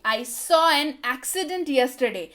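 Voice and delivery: slow and clear, word by word; slow model sentence. Shown as a demonstration. Teacher reads the English line slowly for Hindi students. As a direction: spell it out slowly for learners. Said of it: "teacher models the English sentence".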